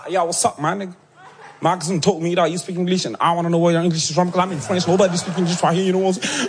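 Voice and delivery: easygoing American street English, lightly voiced; American accent impression. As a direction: play the American cousin sizing him up, in an American accent. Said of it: "très bel accent d'ailleurs".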